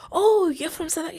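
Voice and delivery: high, quick, eager question; excited greeting. Retold or imitated. Woman meeting a supposed fellow South African, excitedly. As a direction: imitate delighted discovery of a compatriot.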